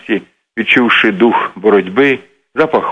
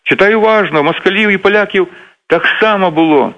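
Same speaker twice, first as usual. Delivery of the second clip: retold shout; 8 semitones higher than usual. Second man shouting back in the office argument, mildly shouted. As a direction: answer back in a mild mock yell. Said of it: "кричить також".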